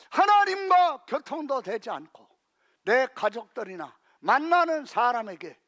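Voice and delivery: ordinary talk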